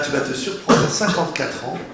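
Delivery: usual speaking voice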